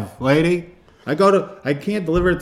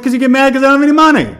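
fast, high, loud comeback; exasperated yell; 10 semitones higher, 10 dB louder, faster than usual. Retold or imitated. Answering girlfriend in a frantic loud rush. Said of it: "I'm like".

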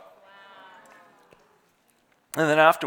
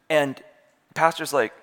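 ordinary talk

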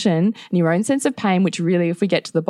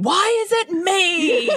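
high, slow shouted why is it me; exasperated shout; 11 semitones higher, slower than usual. Retold or imitated. Wife retelling the fight with her husband, shouting slowly. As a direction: shout it out, fed up and pleading.

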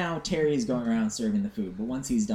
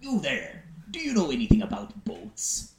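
lightly voiced beaked creature; a hint of a talking bird; talking bird's voice; 3 semitones higher than usual. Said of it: "Pecker's beak opens and this comes out".

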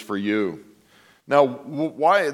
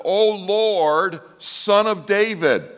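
raised pleading cry, higher; 6 semitones higher, slower than usual. Retold or imitated. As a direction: call out to him as a desperate mother. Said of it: "she cries out to him".

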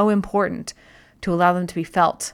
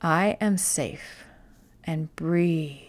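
soft and slow, like a calming mantra; quiet self-soothing words; slow soft reassurance; 2 semitones lower, 6 dB quieter, much slower than usual. Shown as a demonstration. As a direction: tell yourself you're safe, gently. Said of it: "just tell yourself".

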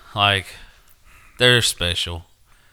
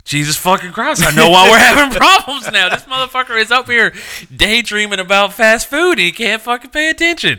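high, loud laughing exasperation; exasperated rant; 13 semitones higher, 7 dB louder than usual. Retold or imitated.

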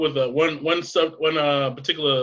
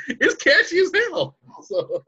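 laughing; 12 semitones higher, slower than usual. Real, not acted. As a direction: laugh through the words. Laughing while talking.

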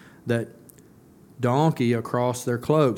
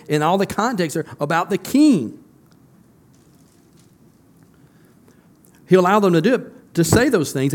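higher and louder, building fervent sermon; rising, passionate pulpit climax; preaching at full force; 5 semitones higher, 4 dB louder than usual. Real, not acted. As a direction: drive the point home to the congregation.

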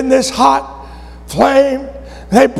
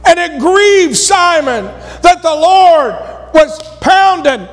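rising preacher's fervour, a touch higher; preaching; 5 semitones higher than usual. Acted. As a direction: press the point harder as you go. Preacher builds to shout.

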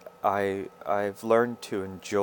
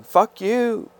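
high, loud re-enacted yell; retold yelling; 12 semitones higher, 5 dB louder than usual. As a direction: shout it out like you did back then. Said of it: "I was screaming and yelling".

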